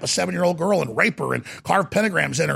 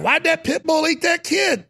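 mock bewilderment; 11 semitones higher than usual. People asking why about a pit bull, mock bewilderment. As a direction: cry out the question like a baffled onlooker. Voices people baffled by the attack.

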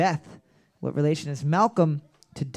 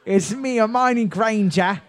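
higher put-on British accent; Hermione Granger; 4 semitones higher than usual. Host greeting Harry as his classmate, mock British accent. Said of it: "best accent you've ever done".

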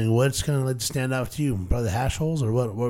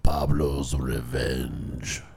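much lower, very slow announcement; deep dramatic voice; 12 semitones lower, much slower than usual. Pretend. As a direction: boom the name like a movie trailer. Dramatic strain name reveal.

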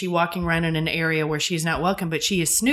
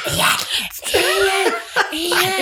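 whisper yelling; 13 semitones higher, 4 dB louder, much slower than usual. Retold or imitated. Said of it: "what Claire going".

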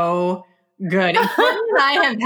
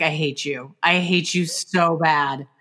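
retold grudge; 5 semitones lower than usual. Retelling deadpan reaction to being pranked.

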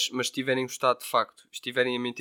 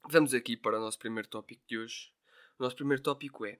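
normal talking voice

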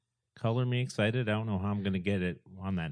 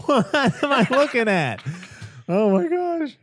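laughing surprise; 14 semitones higher, 8 dB louder than usual. Real, not acted. Laughing shock at photo.

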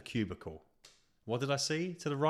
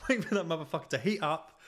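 laughing through the words; real giggling; 6 semitones higher than usual. Real, not acted. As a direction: crack up mid-sentence. Laugh at own story.

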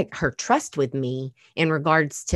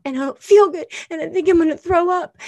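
put-on high, fast, frightened little girl; distressed child voice; 6 dB louder, faster than usual. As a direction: voice a sick scared kid. Child feeling sick, panicking.

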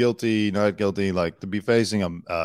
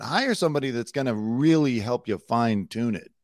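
ordinary talk

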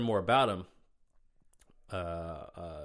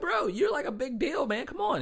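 excited disbelief; 4 dB louder than usual. Retold or imitated. Amazed by friend's famous clients.

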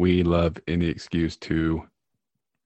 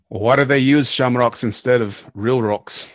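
put-on slow, louder, higher hammy Irish accent joke; poor Irish accent; 5 semitones higher, 6 dB louder than usual. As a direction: set up the pun in a stage Irish accent. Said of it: "our poor attempt at an Irish accent".

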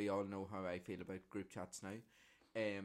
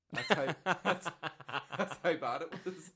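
higher, louder, through laughter; laughing; 7 semitones higher, 8 dB louder than usual. Real, not acted. Capping the story of catching covid from a bad game, laughing. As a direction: crack up while talking.

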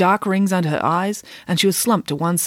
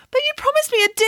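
very high, whiny schoolgirl wail; acted wail; faster than usual. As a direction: wail it high, like a cheated pupil. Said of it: "wailed Mirabella".

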